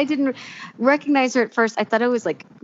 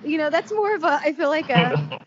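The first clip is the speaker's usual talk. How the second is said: normal talking voice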